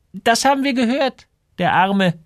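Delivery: very high gossiping woman's voice; shopkeeper woman. A narrator's reading. Shopkeeper Frau Enström comments on illness. (hohe, tratschende Ladenfrau)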